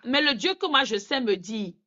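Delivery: ordinary delivery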